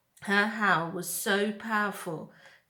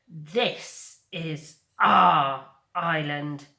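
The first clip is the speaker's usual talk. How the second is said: slow, louder bear snarl; 2 semitones lower, 6 dB louder, much slower than usual. Acted. Bear Nettle snarling at the robot, slow and louder. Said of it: "snarled Nettle".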